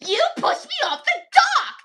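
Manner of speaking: put-on high, loud accusing yell at a boy; kid's accusing yell. Upset camper Hannah yelling at Xavier, high loud accusation. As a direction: shriek the blame at him like an upset kid.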